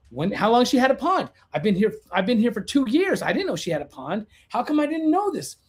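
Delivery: high-pitched, indignant inner questions; incredulous. Retold or imitated. Racing thoughts about unknown pond.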